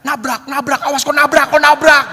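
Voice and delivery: high, loud warning cry; alarmed yell. Retold or imitated. Anton on the back seat yelling a crash warning. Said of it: "Anton teriak".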